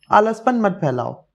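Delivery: ordinary delivery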